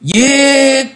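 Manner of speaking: hét lớn, cao vút; gào một tiếng giết; hét vang. A narrator's reading. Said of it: "rồi bỗng lại hét vang". (tiếng hét vang rền)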